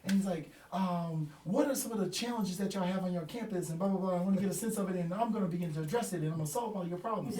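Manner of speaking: high, eager presenter voice; energetic speaker. Retold or imitated. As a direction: hype it up like a keynote speaker. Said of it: "highly energetic guy".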